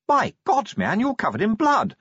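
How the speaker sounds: high-pitched cry of alarm; shocked exclamation. A narrator's reading. Shocked exclamation at blood in story.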